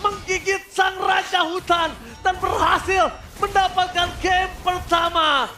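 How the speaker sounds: high, loud hype shouting; fighting game announcer. Acted. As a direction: scream the kills like an arena announcer. Caster shouting through teamfight.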